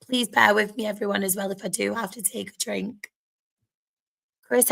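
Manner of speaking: normal talking voice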